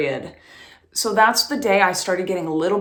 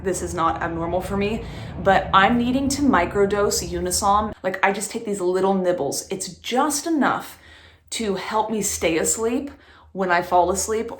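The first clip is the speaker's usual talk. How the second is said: normal talking voice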